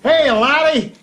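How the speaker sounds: a deliberately bad Irish voice, played for laughs; bad Irish accent. Joking about a subscriber's name in a hammy Irish accent. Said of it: "I tried to do a really bad Irish accent".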